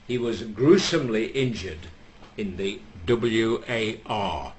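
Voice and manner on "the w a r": slow, letter by letter W, A, R; spelled out. Shown as a demonstration. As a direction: spell out the W A R slowly and gravely. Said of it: "I'm not allowed to mention it properly".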